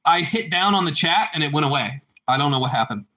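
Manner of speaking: usual speaking voice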